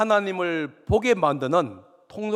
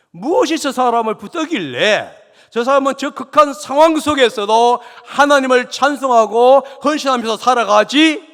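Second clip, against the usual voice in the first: loud, high preaching voice for an onlooker's question; impassioned rhetorical question; 9 semitones higher, 11 dB louder than usual. Acted. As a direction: cry out the onlookers' wonder at full pulpit volume.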